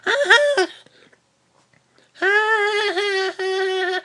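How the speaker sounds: high, loud mock cackle. Imitating Jean cackling at the skunks, high and loud. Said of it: "She'd be cackling and shit".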